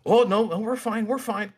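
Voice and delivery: high-pitched, panicky self-reassurance; mock panic. Trader watching his losing trade all night, mock panic.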